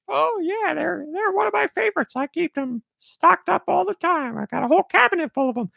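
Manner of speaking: squeaky George voice. Acted. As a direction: pitch way up into a happy squeaky character. George answers about cookies.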